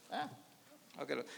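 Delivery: ordinary talk, nothing marked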